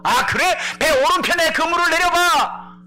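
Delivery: high, loud, slow instruction from afar; calling out. Acted. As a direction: call the instruction across the lake. Jesus calls to the boat. (멀리서 크고 느리게 외치는 지시)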